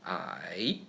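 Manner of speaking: low dipping I, third tone. Shown as a demonstration. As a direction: sink I low then let it rise. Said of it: "the third tone in Chinese".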